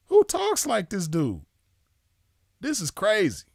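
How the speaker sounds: can't believe what he heard; incredulous. Real, not acted. Streamer reacting to Boom's clip with disbelief.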